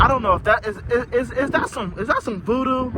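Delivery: spooked, voice shooting up; high surprised. Real, not acted. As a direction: pitch jumps up high in alarm. Spooked by chicken bone.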